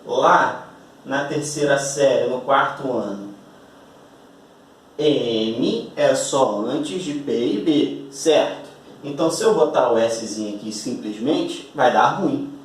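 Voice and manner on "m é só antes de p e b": each word spaced out like a schoolteacher; slow recited rule. Retold or imitated. Teacher reciting the old school rule slowly. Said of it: "o problema que a tia Carola te ensinou".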